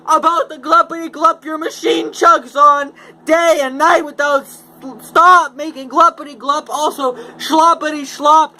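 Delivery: high, loud, scolding creature voice; indignant Lorax. Acted. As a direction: scold him like a furious little creature. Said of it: "his dander was up".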